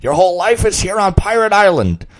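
loud, high coaxing pirate; pleading pirate. Acted. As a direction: appeal to him emotionally. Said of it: "tried to appeal to the boy's emotions".

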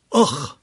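low choked groan of grief; a pained ugh, voice catching; anguished groan. Acted. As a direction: let the plea collapse into a groan. Plea breaks off in anguish.

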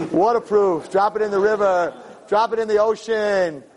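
lightly voiced pitchman touting the product; boastful sales pitch. Preacher acts out Apple touting its waterproof phone, boastful sales pitch.

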